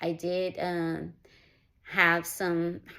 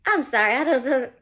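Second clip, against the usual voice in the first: higher, louder tearful outburst; breaking into tears while apologizing; crying; 6 semitones higher, 10 dB louder than usual. Real, not acted. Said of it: "I did not plan on getting teary-eyed".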